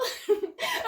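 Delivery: a quick high giggle. Shown as a demonstration. Host demonstrating her own giggle to children, high giggle. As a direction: show them what a giggle sounds like. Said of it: "I know I giggle".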